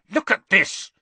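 Harry furious, showing Hermione the article; snarled. Acted. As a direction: shove the words at her. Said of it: "Harry snarled".

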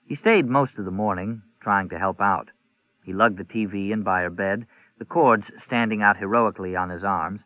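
ordinary delivery

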